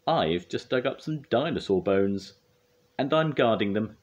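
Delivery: firm, high and loud; proud guard who allows no nonsense; Scoop. A narrator's reading. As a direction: lay down the rule firmly. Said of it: "said Scoop firmly".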